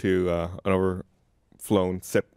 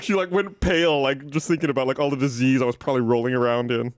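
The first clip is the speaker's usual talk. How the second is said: usual speaking voice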